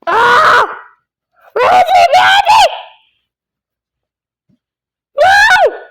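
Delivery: high frantic yelling; panicked shouting. Real, not acted. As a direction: panic as you get hunted. Panicking as enemy attacks.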